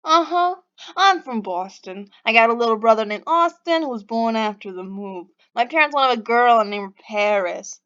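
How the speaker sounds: loud, badly faked Boston accent; Denver. Stranger explaining his family's names to Pixie, Boston accent. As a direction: lay on a hammy Boston accent. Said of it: "my terrible Boston accent".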